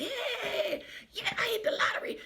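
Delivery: high, breathy whisper of a secret win; giddy lottery winner. Retold or imitated. Lottery winner in the store, high excited whisper. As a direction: whisper it like you can't believe your luck.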